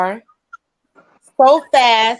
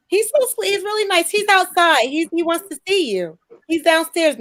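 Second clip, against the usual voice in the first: high-pitched imitation of an approving mom; imitating gushing mother; 5 semitones higher than usual. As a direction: pitch it up as a mother talking him up. Voicing mother praising the guy.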